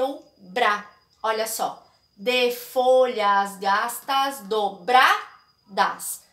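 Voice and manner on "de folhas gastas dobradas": slow metric scansion, merging "de" into "folhas" and stopping at "dobradas"; scanning the verse. Shown as a demonstration. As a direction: count the poetic syllables slowly up to the last stressed one. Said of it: "Eu paro de contar na sílaba tônica". (escansão poética, bem devagar)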